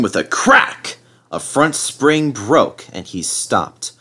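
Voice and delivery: ordinary delivery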